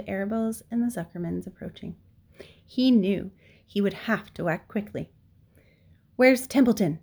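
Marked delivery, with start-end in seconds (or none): 6.2-7.0 raised, urgent question; loud, pressing question; demanding. A narrator's reading. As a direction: demand an answer at once. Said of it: "he demanded".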